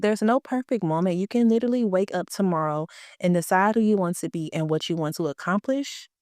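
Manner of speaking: ordinary talk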